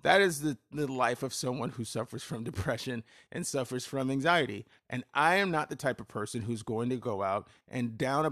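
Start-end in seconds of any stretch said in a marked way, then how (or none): none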